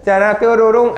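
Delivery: high, loud, playful sparkle effect; magical transformation jingle. Acted. Teacher showing the bride shedding her ugly skin, magical transformation jingle. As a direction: voice a cartoonish magic-wand flourish.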